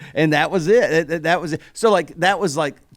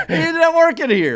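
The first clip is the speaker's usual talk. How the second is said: laughing, high and loud; 12 semitones higher, 6 dB louder than usual. Real, not acted. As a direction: chuckle it out. Laughing at irony.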